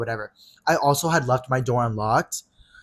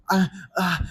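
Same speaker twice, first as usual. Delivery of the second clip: higher, halting noises of hesitation; imitated nervous stammer; 5 semitones higher than usual. Friend confronted and not knowing what to say, nervous. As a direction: imitate someone lost for words. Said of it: "like, nervous".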